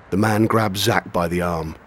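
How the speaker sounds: usual speaking voice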